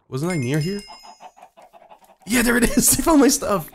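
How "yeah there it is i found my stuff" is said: high, loud cry of relief; triumphant excitement. Real, not acted. Player finding lost stuff, triumphant shout. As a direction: shout the discovery with delight.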